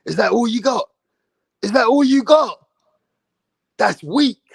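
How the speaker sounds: lightly imitated Diaz taunt. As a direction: goad him like a fighter. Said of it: "He was telling Jake Paul".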